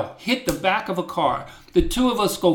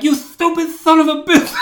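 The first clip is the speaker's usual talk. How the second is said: yelling an insult, high and loud; angry shout; 10 semitones higher, 6 dB louder than usual. Retold or imitated. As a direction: retell the outburst at full blast. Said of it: "and I'm like".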